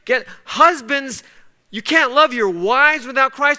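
high, loud climactic preaching; preacher pressing the point home; preaching at full force. Acted. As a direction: build to a fervent peak.

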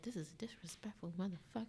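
unvoiced, hushed aside; whisper. Reading rude DMs at night, whispered. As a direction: breathe it out like a secret. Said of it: "I'm just like".

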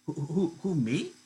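lightly voiced Lenny caught off guard; flustered Lenny. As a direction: stumble over who, caught out.

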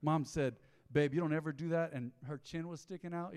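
ordinary talk

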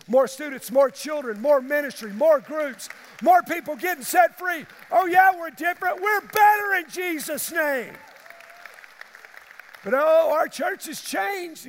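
loud, high, building shout; preaching. Real, not acted. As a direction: preach it to the back row. Preaching at full force.

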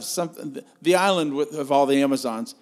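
usual speaking voice

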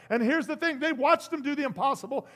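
ordinary delivery

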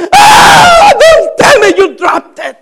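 high, loud, drawn-out panicked shout, half laughing; alarmed boss yell. Retold or imitated. Boss sees him back too soon and yells in alarm. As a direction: yell it like the boss fears the worst.

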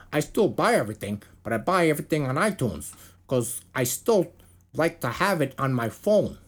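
put-on Minnesota accent. Podcaster reading bassist's quote on buying music, attempted Minnesota accent. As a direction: imitate a Minnesotan, not quite landing it. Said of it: "Is that how a guy from Minnesota talks?".

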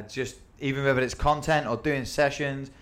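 ordinary delivery

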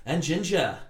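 lightly imitated Australian accent; imitating the Australian accent. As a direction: echo the Australian reading of "And ginger". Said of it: "And then you're like".

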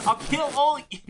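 high Philly accent attempt that breaks off; failed Philly accent. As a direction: try the Philly accent and fumble. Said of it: "I can't do it".

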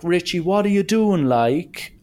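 high, sharp mother's scolding; Irish mother scolding. Retold or imitated. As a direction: call out like a rural Irish mum catching her son. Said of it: "would come out and be like".

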